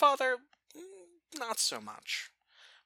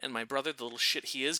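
normal talking voice

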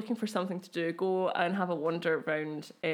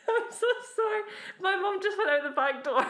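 laughing; 13 semitones higher than usual. Real, not acted. Laughing at mum interrupting.

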